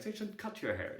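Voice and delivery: high, lightly imitated grandma; cute elderly grandmother. Grandmother ordering grandchild's haircut, lightly imitated high cute voice. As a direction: tell off a grandchild in a hint of a high granny voice. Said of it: "just the elderly, cute grandmother".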